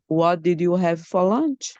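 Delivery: level question rising on 'lunch'; changed intonation, rising. Shown as a demonstration. Student offering another version to teacher, rising question. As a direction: keep it even, then lift 'lunch' at the end. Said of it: "I can say".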